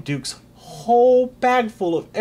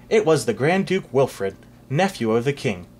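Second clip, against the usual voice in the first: ordinary delivery, nothing marked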